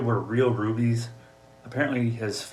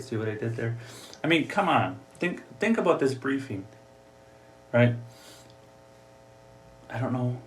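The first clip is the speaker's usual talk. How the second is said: usual speaking voice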